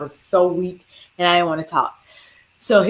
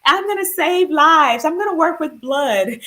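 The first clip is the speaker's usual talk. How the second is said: playful mock child announcing her dream; mock little daughter; 8 semitones higher than usual. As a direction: ham up a young girl's big dream. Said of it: "this is when my young daughter said".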